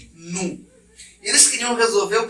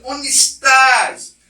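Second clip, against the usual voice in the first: calling God; 5 semitones higher, 7 dB louder, much slower than usual. Acted.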